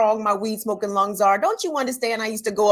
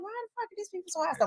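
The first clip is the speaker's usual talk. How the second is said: fast inner rant; 9 semitones higher, 10 dB quieter, much faster than usual. Retold or imitated. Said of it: "And I'm like".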